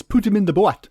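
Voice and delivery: high, hammed-up Shetland accent; overdone Shetland accent. Mocking a supposedly fake Scottish actor, overdone Shetland accent. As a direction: overdo the strange Shetland vowels. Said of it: "absolutely overdoing it in a variety of ways".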